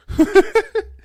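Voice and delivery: real giggling at his own digression; high burst of laughter. Real, not acted. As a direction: let a shrill cackle out.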